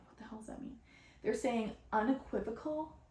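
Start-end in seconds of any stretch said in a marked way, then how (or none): 0.1-0.8 whispered baffled aside. Retold or imitated. Puzzled by odd words, whispered aside. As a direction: mutter it unvoiced to yourself. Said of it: "sometimes I'm like".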